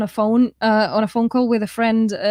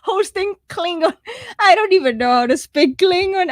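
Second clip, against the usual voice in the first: amused disbelief; 9 semitones higher than usual. Real, not acted. Laughing at a hosting request.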